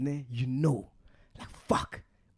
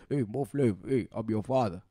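deep-ish father act; fake father; 5 semitones lower than usual. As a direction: lower voice of a fake long-lost father. Prank call as fake father.

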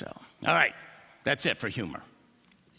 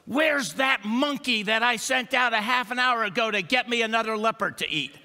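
louder, higher, fast complaint; loud bluffing dog; 7 semitones higher, 5 dB louder than usual. Acted. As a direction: bluff loud so the leopard hears. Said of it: "that little dog goes".

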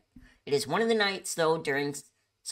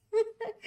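high giggle. Real, not acted. Laughing at the impersonator.

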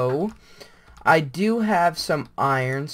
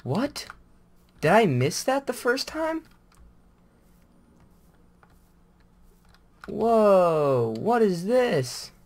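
real surprise; 7 semitones higher, 3 dB louder, slower than usual. Real, not acted. Finds something strange in game.

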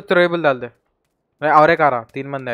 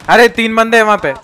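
louder, higher sudden warning; alarmed shout; 6 semitones higher, 7 dB louder than usual. Real, not acted. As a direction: warn the squad in alarm. Warning teammates of three enemies. (घबराकर ज़ोर से चेतावनी देना)

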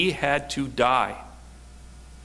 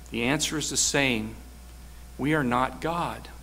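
usual speaking voice

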